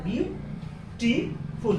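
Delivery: "ब्यूटीफुल" slowly split into three beats with pauses; syllable by syllable. Shown as a demonstration. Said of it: "तीन syllable है". ("ब्यूटीफुल" को धीरे-धीरे तीन हिस्सों में तोड़कर बोलें)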